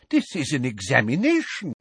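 mildly scandalized reproach; a touch of shocked propriety. A narrator's reading. Professor reminding students of the exam, mildly scandalized. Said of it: "in a scandalized voice".

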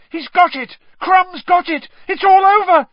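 very high, loud, excited shout. A narrator's reading. Harry seeing the match won, shouting. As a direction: shout it out, building to a peak. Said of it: "shouted Harry".

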